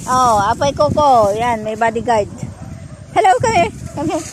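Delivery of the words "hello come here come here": high-pitched call to a pet; calling the dog. Real, not acted. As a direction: call the dog over in a higher voice.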